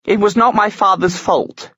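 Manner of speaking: high outraged protest. A narrator's reading. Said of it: "Tatiana looked outraged".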